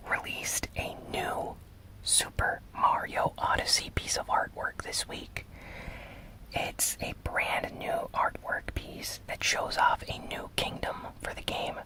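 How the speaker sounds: normal talking voice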